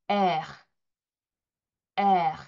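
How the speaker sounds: 에르 with air pushed from the throat; throaty French r. Shown as a demonstration. Teacher demonstrates guttural French r. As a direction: breathe 에르 out from the back of the throat. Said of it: "목구멍 뒤에서 공기를 밖으로 내뱉어".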